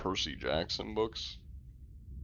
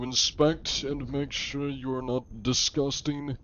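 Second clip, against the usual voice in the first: pompous mock-archaic inspector, pitched higher; John Anti-Cheat; 4 semitones higher than usual. Inspector addressing men and peasants aboard, haughty mock-archaic. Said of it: "I just need to throw some, like, thighs".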